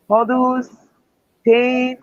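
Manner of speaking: ordinary delivery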